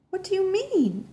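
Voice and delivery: put-on a girl's panicked demand; voice growing stronger. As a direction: push the question up in disbelief. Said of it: "my voice growing stronger".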